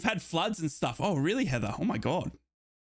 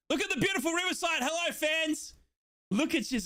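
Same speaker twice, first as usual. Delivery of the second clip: excited greeting; 9 semitones higher than usual. Real, not acted. Streamer greets fans at stadium.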